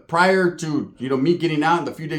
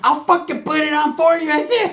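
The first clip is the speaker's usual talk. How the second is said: mock scream; 10 semitones higher than usual. Cousin screams mid-fight, high taunting yell. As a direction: scream it high in his face. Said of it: "he's screaming".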